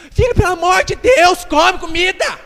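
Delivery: high, loud, desperate plea; imploring. Acted. Father begs son to eat, exaggerated imploring. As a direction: beg at the top of your voice, hammed-up. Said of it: "pai implora".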